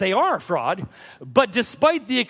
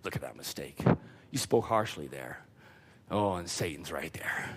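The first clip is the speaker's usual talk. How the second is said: hushed, breathy taunting of the devil; devil's whisper; 13 dB quieter than usual. Acted. Preacher voices the devil taunting a believer, hushed whisper. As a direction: murmur the accusations like a lurking devil.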